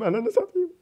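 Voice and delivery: high, squealing swear; shocked squeal. Real, not acted. Panic under attack. (şaşkın, tiz ciyaklama)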